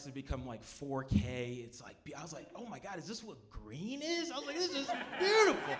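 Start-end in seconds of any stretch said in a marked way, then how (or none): 2.6-5.8 high-pitched wonder, swelling at the end; acted-out amazement at the colours; awestruck tripper. Tripper marvels at what green is, awestruck.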